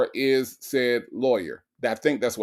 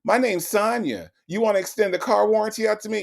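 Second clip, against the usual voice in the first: high, eager flirting voice; flirty mock Sonya; 8 semitones higher than usual. Host imitating Sonya sweet-talking the warranty caller, high flirty coo. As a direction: sweet-talk the scammer, pitched way up. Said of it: "This is how she talked to the scammers".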